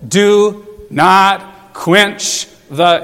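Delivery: very slow, each syllable set apart; word by word. Shown as a demonstration. Preacher showing the church the one-syllable words, very slow. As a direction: lay "Do not quench the" down one word at a time. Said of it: "Some monosyllabic words, one syllable".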